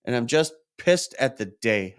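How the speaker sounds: ordinary delivery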